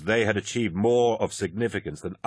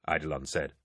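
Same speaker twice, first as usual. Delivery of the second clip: plain reading voice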